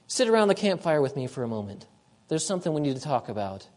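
soft, low and hushed, like calling a friend aside; gentle invitation. Acted. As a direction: draw him close quietly to the fire. God gently calls the sinner aside.

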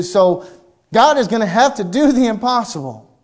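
voiced widow. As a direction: argue it out as the widow, emphatic. Widow voiced reasoning aloud.

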